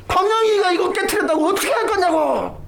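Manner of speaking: hammed-up outraged neighbour; mock angry complaint. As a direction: neighbour complaining to mother about the broken glass. Angry man complains to mother.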